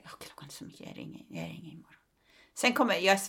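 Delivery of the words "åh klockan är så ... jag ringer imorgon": whisper, rushing the last part; whispered thought. Retold or imitated. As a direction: talk yourself out of calling, under breath. Inner thought, putting off call. (viskad tanke till sig själv)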